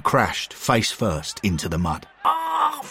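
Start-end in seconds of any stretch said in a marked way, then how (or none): 2.2-2.8 pained cry. A narrator's reading. McBain crashes into the mud.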